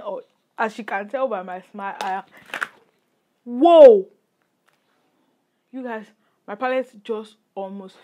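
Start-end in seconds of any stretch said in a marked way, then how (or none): 3.4-4.1 sudden loud startled cry; startled whoa. Real, not acted. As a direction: yelp as something nearly drops. Palette almost falls.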